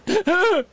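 burst of laughter. Real, not acted.

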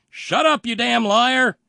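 high, full-force yell; loud, sustained angry shout. Acted. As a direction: bellow it like an outraged heckler. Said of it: "also shouting".

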